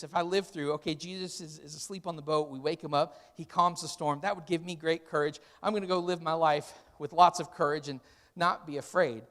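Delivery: ordinary talk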